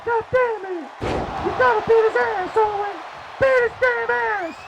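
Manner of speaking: very high-pitched angry manager impression; Jim Cornette. Commentator imitating the manager egging on Owen, high-pitched outrage. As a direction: squawk it far above your own voice, urging your man on. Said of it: "he's not saying it like that".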